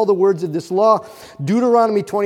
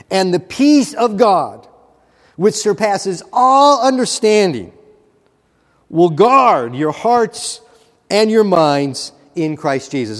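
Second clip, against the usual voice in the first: solemn scripture reading; 2 semitones higher, 4 dB louder, slower than usual. Acted. Reads scripture verse aloud.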